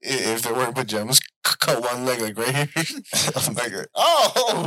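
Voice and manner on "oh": mock startled yelp.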